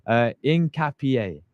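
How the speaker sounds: slow, careful "Incapié" as in-ka-pi-AY; practising the name. Shown as a demonstration. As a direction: drill "Incapié" syllable by syllable to memorise it. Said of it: "say it over and over again".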